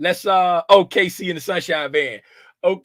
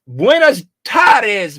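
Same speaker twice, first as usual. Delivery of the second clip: drawn-out greeting; 3 semitones higher, 6 dB louder, much slower than usual. Pretend. Spanish greeting to viewer.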